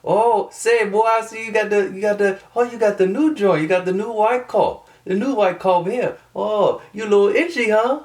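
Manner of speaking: badly faked New Orleans accent, very high; Brandon. College friend ribbing him about his car, mock New Orleans accent. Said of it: "I cannot do a New Orleans accent".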